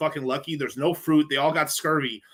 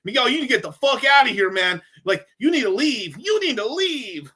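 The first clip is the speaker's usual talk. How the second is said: higher, louder mock drunk buddy telling him off; imitating drunk friend; 9 semitones higher, 5 dB louder than usual. Drunk friend in Vegas tells Miguel to leave, mock insistent and higher. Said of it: "And he's like".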